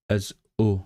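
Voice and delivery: "uh" said as a short Northern English u vowel. Shown as a demonstration. Explaining Welsh accents, a Northern English vowel. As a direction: say "uh" the way a Northern English speaker would. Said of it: "they pronounce".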